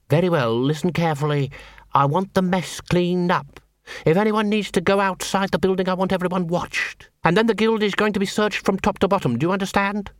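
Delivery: a cold leader issuing commands; commanding guild head. Acted. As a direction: lay down the law to the council. Cruce giving orders.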